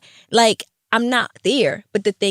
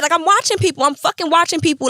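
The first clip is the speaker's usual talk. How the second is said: heated insistence; 7 semitones higher than usual. Real, not acted. Heated repeat.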